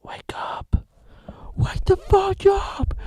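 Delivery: high, strained whisper-yell, very loud; whispered wake-up shout. Pretend. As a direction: screech at yourself to wake up. Shouting himself awake.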